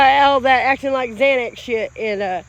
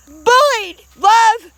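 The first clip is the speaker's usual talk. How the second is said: loud, higher single-word cries; impassioned outburst; 6 semitones higher, 5 dB louder, much slower than usual. Real, not acted. Vlogger fed up with being bullied, impassioned outburst. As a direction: punch out each word, high and loud.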